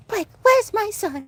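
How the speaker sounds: high, haughty Alexis voice, British-tinged; mock the grand soap diva demanding her son; campy high diva impression; imitating Alexis. Imitating Alexis confronting Blake.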